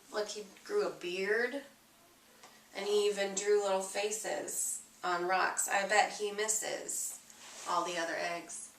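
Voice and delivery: soft aside. Real, not acted. Reader commenting on the picture to a child, quiet. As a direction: lean in and murmur about the picture.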